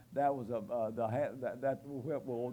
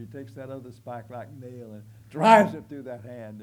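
loud preaching burst; 14 dB louder than usual. Real, not acted. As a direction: swell loud on the second nail. Second nail driven.

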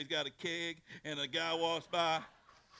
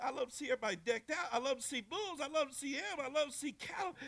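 high, fast gushing; excited; 11 semitones higher, faster than usual. Retold or imitated. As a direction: rattle off the things you love, much higher. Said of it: "and he said".

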